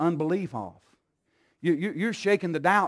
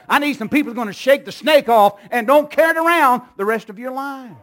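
fast, rising, louder sermon run; building preaching; 4 semitones higher, 9 dB louder, faster than usual. Acted. Pastor calling for people to shake off bitterness, building preaching. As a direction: build the list to a peak.

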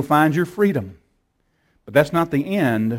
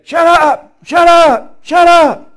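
very high, loud screaming shut up; furious demon shout; 12 semitones higher, 7 dB louder, much slower than usual. Retold or imitated. Demons going ballistic as the Word is read, screaming. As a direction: shriek it like a raging demon. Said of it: "The demon voices went ballistic".

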